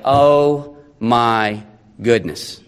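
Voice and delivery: low, slow, exasperated sigh of words; weary sigh. Acted. Preacher acting Pilate faced with a messy case, sighing wearily. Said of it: "you can just see Pilate sigh".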